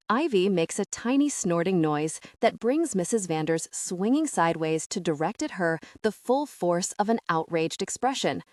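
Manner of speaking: ordinary delivery, nothing marked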